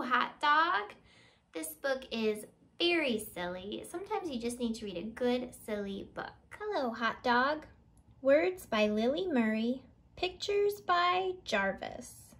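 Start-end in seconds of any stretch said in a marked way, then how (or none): none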